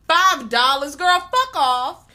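high, loud, drawn-out outrage; outraged shout. Real, not acted. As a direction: shout it in scandalized disbelief. Outraged at the meagre payment.

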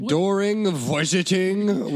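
slow mock European accent; mock European accent, drawn out. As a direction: ham up a vague European voice. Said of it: "I like European Bill".

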